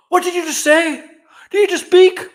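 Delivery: high, loud, overjoyed outburst; hammed-up loud, high-pitched astonishment; mock excited shock. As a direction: exclaim it like a thrilled parent. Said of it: "He won't be excited".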